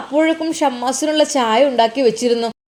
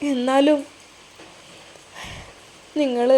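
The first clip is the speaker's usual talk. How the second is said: halting, tearful words, long pauses between; put-on crying; 4 dB quieter than usual. A narrator's reading. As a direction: break off as if choked by tears. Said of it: "അവൾ അങ്ങ് കരഞ്ഞ് അഭിനയിച്ചു".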